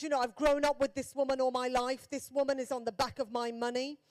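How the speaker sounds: usual speaking voice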